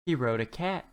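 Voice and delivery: ordinary delivery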